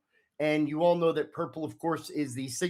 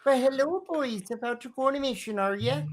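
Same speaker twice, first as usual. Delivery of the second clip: put-on Northwestern accent; 8 semitones higher than usual. Octopus receptionist greets the riders in a Northwestern accent. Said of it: "she talks with a Northwestern accent, kinda like this".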